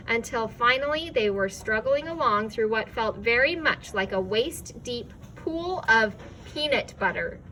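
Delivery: usual speaking voice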